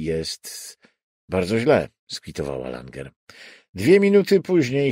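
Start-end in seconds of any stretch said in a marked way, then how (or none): none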